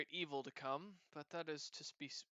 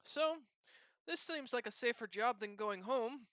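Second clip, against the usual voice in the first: put-on cat-girl voice; 3 semitones higher than usual. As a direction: tell it eagerly like a chatty cat-girl. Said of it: "she looks at you and says".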